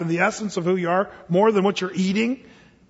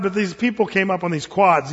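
usual speaking voice

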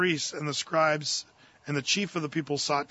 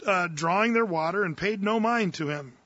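ordinary delivery

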